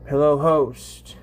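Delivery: usual speaking voice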